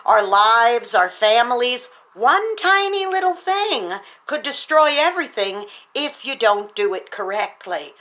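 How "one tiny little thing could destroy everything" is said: higher pitch, weight on tiny; earnest preacher's warning; emphatic repeat. Real, not acted. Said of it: "sermon key point repeated".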